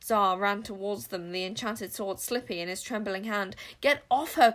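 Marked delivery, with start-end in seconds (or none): 3.8-4.5 loud, higher cry. Acted. Boy yelling at the King Witch to get off her. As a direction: yell at the witch to back off. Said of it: "cried Zar".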